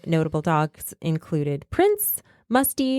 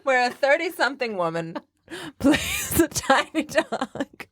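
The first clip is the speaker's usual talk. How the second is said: laughing; 8 semitones higher, slower than usual. Real, not acted. Laughing through the sentence.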